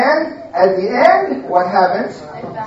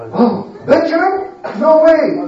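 mock surprise; 7 semitones higher than usual. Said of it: "Looked at each other and said".

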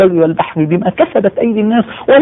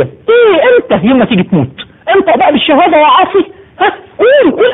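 tearful preaching; 7 semitones higher than usual. Real, not acted. Preacher to congregation about dying sinners, weeping. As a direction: voice breaking with tears, pitched high.

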